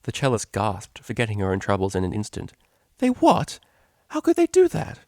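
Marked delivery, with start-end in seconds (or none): 3.0-5.0 high, loud, aghast; shocked gasp. A narrator's reading. Octavia aghast hearing Vinyl was kicked out. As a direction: recoil in disbelief.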